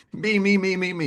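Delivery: mock me-me-me. Mocking Leo self-focus.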